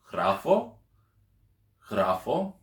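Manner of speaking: "Γράφω" said with a rising question tone; questioning intonation. Shown as a demonstration. Greek teacher showing a question by tone, questioning intonation. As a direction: lift the end of "Γράφω" as if asking. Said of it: "as long as there's a rise in tone".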